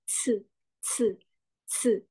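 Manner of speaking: "S" here as "tseu" with a puff of air; thinner c sound. Shown as a demonstration. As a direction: make a thin, aspirated tseu. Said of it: "Lebih tipis". (bunyi "tseu" yang lebih tipis)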